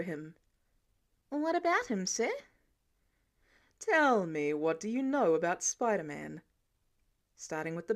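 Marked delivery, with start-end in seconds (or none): 1.3-2.3 innocent question. Acted. Peter playing innocent. 3.8-6.4 probing. Acted. Tony interrogating.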